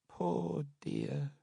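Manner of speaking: put-on high, soft, pitying old nurse; an old nurse pities the dead mother softly. A narrator's reading. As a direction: coo it like a tipsy old nurse. Nurse repeats.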